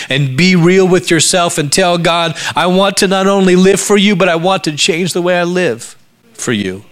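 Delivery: high, impassioned plea to God; heartfelt cry of commitment; fervent preaching. Real, not acted. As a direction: build to an urgent appeal, then ease off. Sermon builds to a fervent plea.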